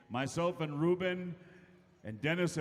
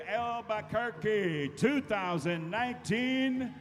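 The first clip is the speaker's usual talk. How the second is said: hype announcer; 6 semitones higher, 4 dB louder, slower than usual. Acted. Emcee hypes crowd to dance.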